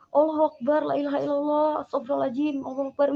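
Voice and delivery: mock-horrified string of prayers; retold alarmed invocation. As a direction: blurt prayers as if facing a cliff. Acting out horrified refusal of church.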